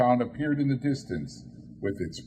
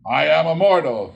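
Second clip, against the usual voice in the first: remonstrating; 4 semitones higher, 9 dB louder than usual. Acted. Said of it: "Scrooge remonstrated".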